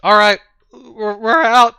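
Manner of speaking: high, loud frantic surrender; panicked shout. Acted. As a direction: yell it while backing away. Fleeing guards give up.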